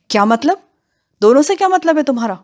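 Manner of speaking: high-pitched startled questioning; indignant disbelief, rising high; alarmed demand. Acted. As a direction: demand an explanation, pitch jumping up. Narrator alarmed at the bandit's hint.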